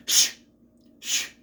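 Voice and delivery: sharp single "shh", then another; imitated shushes; mock shushing. Shushing kids who are laughing, sharp shushes. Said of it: "She go".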